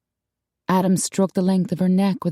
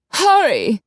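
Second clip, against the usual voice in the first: raised, urgent call through the door; 8 semitones higher, 5 dB louder than usual. Acted. Urging her partner outside the door to hurry, loud urgent call. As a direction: hurry them along, pitched up.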